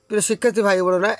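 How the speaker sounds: usual speaking voice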